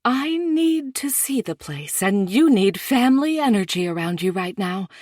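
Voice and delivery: a mother who won't be talked out of it; insistent mom. A narrator's reading. Mom's long speech, higher.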